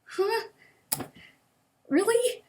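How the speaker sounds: very high, astonished; high disbelieving "really?"; incredulous. Real, not acted. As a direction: sound stunned and doubtful. Disbelief at therapist.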